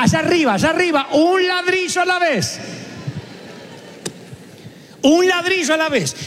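high, ringing preacher's call; preaching climax. Acted. Pastor rallying the anniversary crowd, high and ringing. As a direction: lift it up to the rafters.

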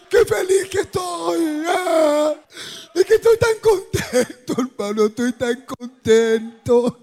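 hammed-up sobbing brother, high drawn-out wails; exaggerated crying testimony, words stretched between sobs; mock tearful joy. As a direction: imitate a man weeping while saying he's happy. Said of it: "va a estar llorando y diciendo que es feliz".

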